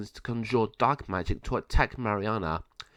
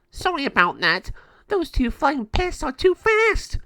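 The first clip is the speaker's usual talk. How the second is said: a dopey gargoyle making excuses; silly gargoyle; 6 dB louder than usual. Acted. Gargoyle one apologises.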